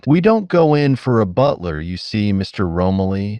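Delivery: plain reading voice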